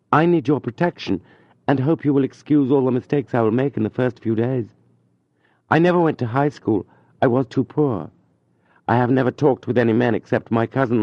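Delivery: a shy newcomer begging for kindness; young tutor pleading. A narrator's reading. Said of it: "Julien's long pleading speech".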